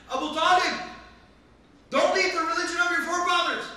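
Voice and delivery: high, full-force shouted warning; screaming loudly. Acted. Quraysh leader at Abu Talib's deathbed, screaming loudly. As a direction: yell over everyone at the dying man. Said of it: "Started screaming loudly".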